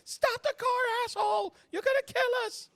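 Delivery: very high, loud shrieking; angry panicked screaming. Acted. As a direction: scream it at a reckless driver. GM voices Abby screaming at driver.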